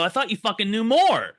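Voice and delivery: high, loud indignant comeback; retold exasperated protest. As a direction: act out indignant disbelief.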